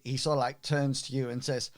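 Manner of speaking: ordinary talk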